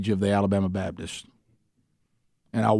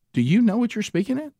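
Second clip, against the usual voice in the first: pitched way up, disbelieving question; incredulous pastor; 11 semitones higher than usual. Retold or imitated. Pastor on the phone, disbelieving the speaker doesn't know. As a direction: ask it like he can't believe you don't know.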